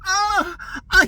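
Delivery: high, hammed-up death groan; over-the-top fake dying cry; badly faked dying. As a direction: ham up a fake stabbing victim. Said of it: "he was dying so badly".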